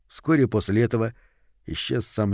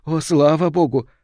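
higher, louder cry of relief; heartfelt, raised exclamation of relief; bursting relief; 6 semitones higher, 5 dB louder than usual. A narrator's reading. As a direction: let the thanks burst out. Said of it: "вырвалось у матери".

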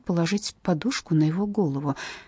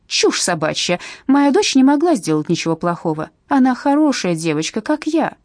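loud, higher angry defence; angry mother; 4 semitones higher, 5 dB louder than usual. Acted. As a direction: spit it back at the social worker. Mother defending her daughter angrily.

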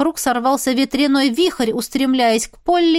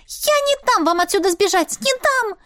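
high-pitched, agitated refusal; nervous determination; 9 semitones higher than usual. A narrator's reading. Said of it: "Полли нервничала".

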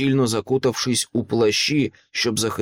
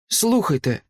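sharp, higher-pitched call to listen; urgent, abrupt order in a raised pitch; sharp command; 9 semitones higher than usual. A narrator's reading. Elf prince halts the group to listen, said sharply. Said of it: "різко сказав він".

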